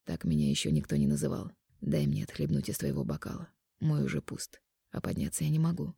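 soft, low man's voice; quiet intimate male lover. Acted. Mark speaks to heroine.

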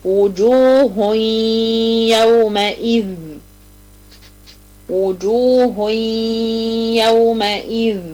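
slow Quranic recitation of وجوه يومئذ with the tanwin merged into the ya; تلاوة مرتلة ببطء. Shown as a demonstration. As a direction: recite وجوه يومئذ slowly with a held ghunna. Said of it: "هاتيلي كده مثال مع التنوين".

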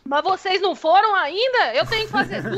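high, fast hot-tempered scolding. Acted. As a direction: shoo them out like a busy mom. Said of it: "É esquentadinha essa daí".

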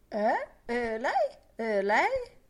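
loud, rising, baffled questions; vague, puzzled reply. A narrator's reading. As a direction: ask as if you missed who spoke. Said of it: "rispose il vago basso di Ben Dollard". (domanda confusa, forte e ascendente)

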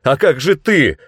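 loud, high cried question; worried shout. A narrator's reading. Hero hesitates to leave Tananda behind, alarmed shout. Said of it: "крикнул я".

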